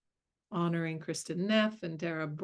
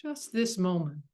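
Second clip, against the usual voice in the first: guided meditation. Acted. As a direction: ease them gently into stillness.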